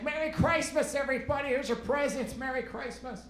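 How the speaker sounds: high-pitched, tipsy holiday greeting, "Christmas" as "CRICE-mas"; hammed-up drunken festive call; drunk grandma. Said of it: "If she gets drunk".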